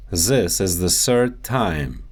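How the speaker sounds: "This" as zis, "third" as surd; Russian Z and S for TH in "This" and "third"; Russian accent. Shown as a demonstration. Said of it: "The T H sound".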